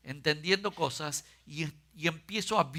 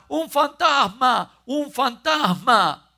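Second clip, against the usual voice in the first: loud, slow, high terrified shout; frightened cry; 8 semitones higher, 8 dB louder, much slower than usual. A narrator's reading. Disciples in the storm shout in terror. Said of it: "llenos de miedo gritaban".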